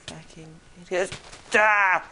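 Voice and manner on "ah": loud, high startled scream; sudden loud yell. Real, not acted. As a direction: scream as if ambushed. Startled by attack.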